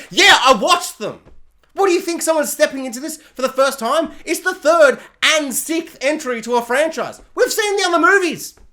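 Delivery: loud, high exasperated rant; angry. Real, not acted. Reviewer answering the recap of old movies, angry shouting. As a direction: blow up in indignation, building to a yell. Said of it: "I'm getting kinda angry now".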